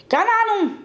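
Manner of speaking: high, mildly angry spell cry; angry incantation. A narrator's reading. Thwarted young man casts a vanishing spell, mild anger. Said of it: "wurde rot vor Zorn".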